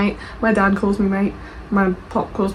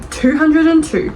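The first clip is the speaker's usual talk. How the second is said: dramatic reading; 7 semitones higher, 3 dB louder, slower than usual. Acted. Reading review opener dramatically.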